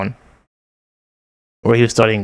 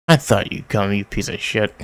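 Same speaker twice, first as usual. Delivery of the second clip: ordinary talk, nothing marked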